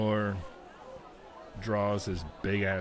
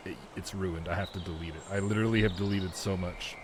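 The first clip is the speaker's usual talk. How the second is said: ordinary talk, nothing marked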